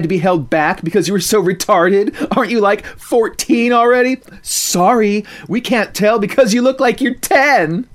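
high, loud sneering schoolgirl; mock her like a spoiled bully; snotty teen mockery; mean girl taunt. Mean girl taunting small classmate.

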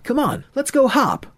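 high, excited hepcat call; eager urging. Acted. Friend drags Murray to dance, eager.

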